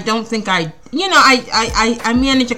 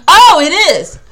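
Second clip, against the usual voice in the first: surprised discovery; 7 semitones higher, 7 dB louder than usual. Real, not acted. Discovers the magazine is real.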